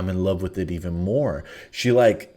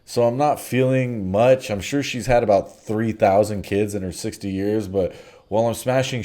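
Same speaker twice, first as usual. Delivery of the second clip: ordinary talk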